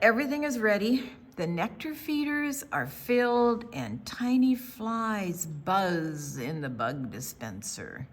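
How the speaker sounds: ordinary delivery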